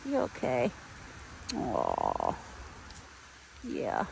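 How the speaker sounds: soft mommy voice for a beloved deer. Real, not acted. Mother cooing to her pet deer.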